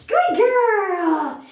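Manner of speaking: excited high dog-praise voice; puppy praise. Real, not acted.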